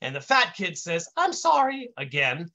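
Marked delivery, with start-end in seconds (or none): 1.1-1.9 high-pitched apologetic intern; high kid voice. A narrator's reading. Fat kid apologizes to the agent, high voice. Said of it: "the kid says in this high voice".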